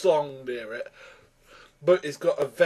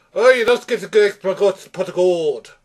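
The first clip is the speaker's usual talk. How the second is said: loud, higher hammed-up Irish accent; bad Irish accent; 4 semitones higher, 7 dB louder than usual. Reviewer mimicking a film leprechaun, hammed-up Irish accent. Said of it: "apologise to any Irish person watching for that accent".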